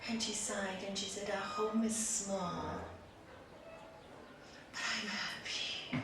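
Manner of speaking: hushed ending to a fable; quiet contentment. A narrator's reading. Quiet resolution of the tale.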